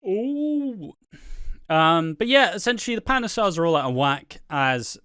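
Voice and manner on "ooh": spooky ooh. Pretend. Mock spooky response.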